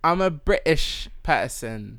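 slow put-on British accent; bad British accent. Shown as a demonstration. Host proving her accent work, bad British accent. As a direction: announce it in a drawn-out fake British accent. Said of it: "This is what you sound like".

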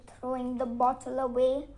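usual speaking voice

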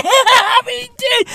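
high, tearful squeal of laughter; laughing mock wail. Comedian imagining no internet, high laughing mock wail.

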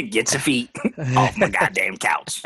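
low annoyed man. Retold or imitated.

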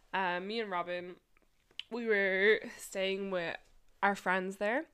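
normal talking voice

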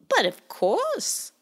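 very high, slow, sly bird voice; sly blackbird. Acted. The blackbird hands Bowie the key, slow sly. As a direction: purr it with a sly smile. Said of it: "with a sly smile".

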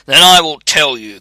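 loud, forceful spirit; crying out. A narrator's reading. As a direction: announce it forcefully. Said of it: "cried the spirit".

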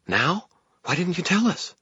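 high-pitched panicky protest. A narrator's reading. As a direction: let the pitch leap as panic sets in. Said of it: "echoed in a panicky tone".

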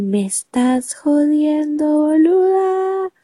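high, slow incredulous voice; high, slow mocking disbelief; mock husband disbelief. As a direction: play the stunned husband, drawn out. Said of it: "Y él".

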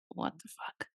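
whispered, stunned aside; hushed, voiceless reaction to a stranger; under-the-breath disbelief. Retold or imitated. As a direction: whisper it as a shocked inner thought. Inner shocked reaction retold.

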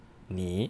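你 soft and low, dipping; third tone. Shown as a demonstration. Teacher defining the word for you, 你 in a low third tone. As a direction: sink 你 quietly into a third tone. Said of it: "in the third tone".

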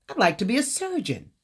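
proud, certain answer; confident. Retold or imitated. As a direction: state it with full confidence. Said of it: "I said with confidence".

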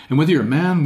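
normal talking voice

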